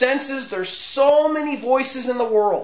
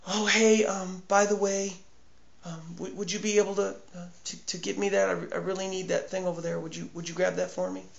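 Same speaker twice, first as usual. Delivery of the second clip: soft, tentative mumbled ask; hesitant casual request; 2 semitones lower, 11 dB quieter, faster than usual. Acted. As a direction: ask quietly and offhandedly, with little ums. Acting out a timid, casual request.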